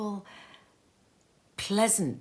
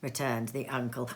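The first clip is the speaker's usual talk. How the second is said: plain reading voice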